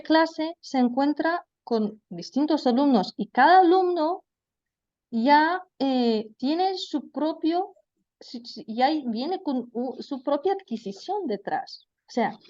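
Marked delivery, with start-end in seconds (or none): none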